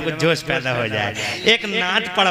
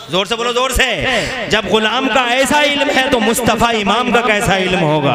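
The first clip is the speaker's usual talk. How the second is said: raised, forceful pulpit call; rallying preaching; 5 semitones higher, 6 dB louder than usual. Real, not acted. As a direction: drive the question home to the crowd. Rallies crowd at full force. (जोश भरी बुलंद तक़रीर)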